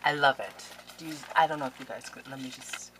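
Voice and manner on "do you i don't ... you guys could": ordinary delivery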